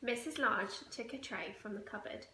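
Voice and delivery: ordinary delivery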